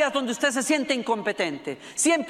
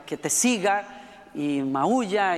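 normal talking voice

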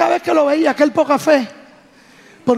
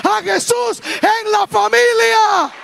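rousing preaching; 8 semitones higher than usual. Real, not acted. Preacher asks the church to praise God, rousing and high. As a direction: call the congregation to praise, pitch lifted.

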